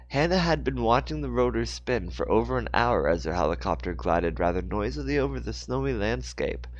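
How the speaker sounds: ordinary delivery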